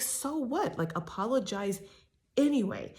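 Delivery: retold exasperated retort. Ariana throwing Tom's excuse back at him, high exasperated retort. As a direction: fling it back at him in disbelief. Ariana confronting Tom in kitchen.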